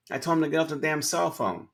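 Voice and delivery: the manager tells the consultant what he said, in mock indignation; mock angry manager. Mock angry retelling.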